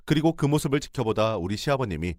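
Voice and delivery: plain reading voice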